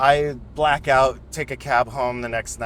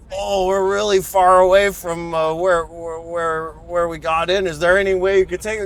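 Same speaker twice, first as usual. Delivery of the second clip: a hint of a sheepish homeless guy, raised; sheepish stranger; 5 semitones higher, 4 dB louder than usual. Homeless guy asking Pete to drive him back, lightly voiced. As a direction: ask meekly for a favour, speeding up at the end.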